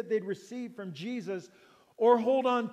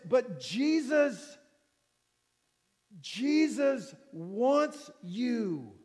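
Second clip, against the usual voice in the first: ordinary talk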